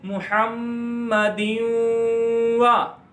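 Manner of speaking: मुहम्मदिन वा slow, long mm, nasal held glide into wa; idgham with ghunna. Shown as a demonstration. Teacher shows how to read with ghunna, drawn-out. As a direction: hum the mm and the n-into-w of मुहम्मदिन वा. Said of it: "तो इसको किस तरह पढ़ेंगे".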